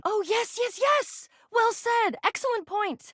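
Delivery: high-pitched, effusive agreement; gushing delight. Acted. Agreeing eagerly with the visitor before beginning, gushing delight. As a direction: burst out with delighted approval.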